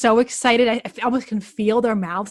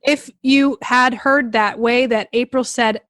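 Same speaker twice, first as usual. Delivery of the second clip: ordinary talk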